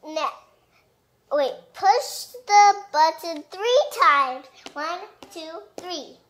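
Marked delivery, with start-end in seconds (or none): none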